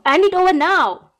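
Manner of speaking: a stalker yells for her crush's phone; angry shout. Acted. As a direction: snap the order at the captive. Demands the phone again.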